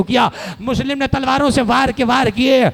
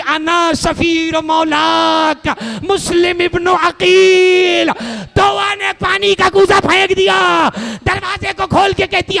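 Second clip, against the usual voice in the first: wailing sermon lament; 6 semitones higher than usual. Acted. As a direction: stretch each word out in mourning. Majlis lament at full force.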